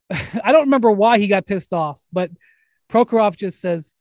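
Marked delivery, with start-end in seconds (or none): none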